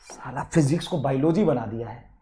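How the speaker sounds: low, soft grumble under the breath; muttered aside. Real, not acted. Physics teacher grumbling to himself about the class, muttered. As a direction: mutter it to yourself in disgust.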